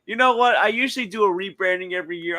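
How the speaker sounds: ordinary talk, nothing marked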